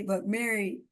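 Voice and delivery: ordinary delivery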